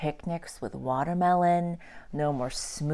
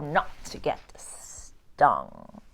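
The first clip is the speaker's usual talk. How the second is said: breathy, secretive whisper, slowly; 4 semitones lower, 4 dB quieter, much slower than usual. Real, not acted. Teacher whispering the safety tip to the kids. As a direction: lean in and whisper it slow.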